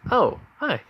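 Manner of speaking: high-pitched, lightly voiced girl; girl's greeting. Girl meets Uncle All Might's company, high greeting.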